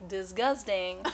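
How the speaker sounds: lightly imitated French accent on disgusting; French-accented dad. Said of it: "he used to always say things like".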